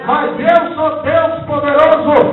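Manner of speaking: loud, fervent angel's message; preaching at full force. Acted. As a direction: proclaim God's promise to the church. (pregação fervorosa e alta)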